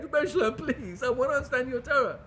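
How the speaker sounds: pleading to his rebbe; a light pleading voice, reaching up; mild imitation of a begging student.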